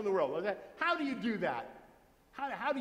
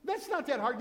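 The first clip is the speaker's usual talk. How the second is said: ordinary talk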